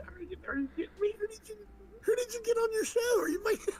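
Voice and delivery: very high, squeaky mock disbelief; mock incredulity. Imitating someone appalled at who got on the show, mock incredulity. Acted incredulous outrage at booking.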